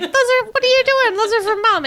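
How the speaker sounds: high, loud mock exasperated dad; Dad scolding. Dad telling the kid the gifts are for Mom, high mock exasperation. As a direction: play Dad catching the kid opening the wrong gift. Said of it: "Dad is like".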